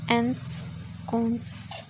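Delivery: एंसकोंस drawn out very slowly, part by part; slow sounding-out. Shown as a demonstration. Teacher working through a vocabulary word, एंसकोंस sounded out slowly. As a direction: stretch out each part of एंसकोंस.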